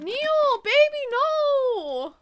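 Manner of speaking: high, loud, drawn-out wail; hammed-up pleading. Player pleading with Neil, hammed-up slow wail. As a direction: plead with long mock-heartbroken vowels. Mock pleading with game character.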